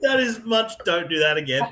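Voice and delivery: high, loud laughing protest. Real, not acted. Co-host laughing at a butchered name, high and loud. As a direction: laugh through a mock-horrified complaint. Mock horror at bad pronunciation.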